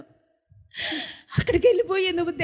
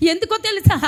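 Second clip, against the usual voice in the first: emphatic question; 6 semitones higher, 5 dB louder than usual. Real, not acted. Rhetorical question to the congregation.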